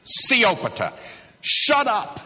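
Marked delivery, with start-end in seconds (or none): 1.4-2.2 much higher, loud "Shut up" with an edge; blunt shout. A narrator's reading. Jesus silencing the sea, high loud blunt shout. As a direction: snap it at the sea like a bully. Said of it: "One word, siopata".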